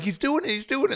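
high, excited put-on voice; watching the bird take off, squealing high; mock excited cheer. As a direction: squeal it like you can't believe it. Retelling excited onlookers.

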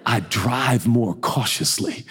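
lightly voiced elder preacher, low and measured; Bishop Jakes. Bishop shares driving wisdom, lightly imitated low voice. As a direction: imitate a sage mentor, pitched low. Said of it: "And he's like".

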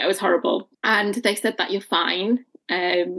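ordinary talk, nothing marked

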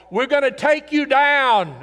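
menacing war cry from the besieging army; enemy threat. Retold or imitated. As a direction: bellow it at the city wall. Enemy army threatening outside walls.

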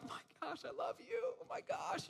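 high, hushed, breathless fangirl gush; starstruck squeal. Retold or imitated. Recalling meeting Pluto and Goofy, breathless starstruck gush. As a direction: gush at the characters like a thrilled kid.

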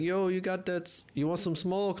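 high-pitched mock street hawker pitch; imitated Rasta hustler. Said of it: "We had this Rasta guy come up".

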